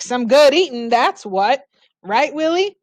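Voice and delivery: put-on old drifter bragging to the dog; folksy old drifter. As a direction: relish it like a happy old hobo. Mookie praising liver pudding.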